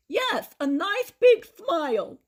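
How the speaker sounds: put-on high, slow, lisping old man voice; toothless Grandpa. A narrator's reading. Grandpa mourns his stolen teeth, high toothless lisp. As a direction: lisp through a mouth with no teeth.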